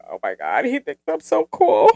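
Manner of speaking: mock giddy. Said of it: "I'm like".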